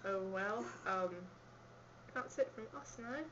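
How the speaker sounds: usual speaking voice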